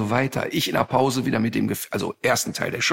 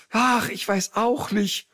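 scared friend; 8 semitones higher, slower than usual. Retold or imitated. Friend voiced in panic.

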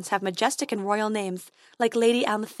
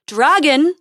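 louder, higher rising call; calling again; 6 semitones higher, 10 dB louder than usual. A narrator's reading. Prince calling the dragon a second time, louder rising call. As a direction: call out even harder this time. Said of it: "he called again".

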